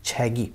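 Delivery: छैगी said joined, with a soft voiced g; correct carry-over pronunciation. Shown as a demonstration. Teacher repeating the right form after the wrong ones, correct carry-over pronunciation. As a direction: say छैगी as chhe-gi, no hard k. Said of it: "इसको ऐसे pronounce करेंगे".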